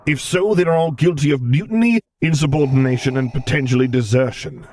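cold, commanding dark lord; stern commander voice. Acted. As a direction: give orders like an imperial lord. Vader's voice in reading.

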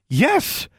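eager, high-pitched yes. A narrator's reading. Alexandra accepting dueling practice, eagerly. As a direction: jump at the offer. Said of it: "Alexandra replied eagerly".

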